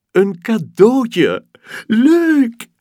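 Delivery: very high, loud squeal, drawn out; excited child unwrapping; thrilled. Acted. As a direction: squeal at the present. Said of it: "zegt Robin".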